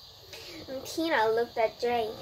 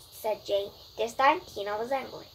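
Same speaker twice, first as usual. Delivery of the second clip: usual speaking voice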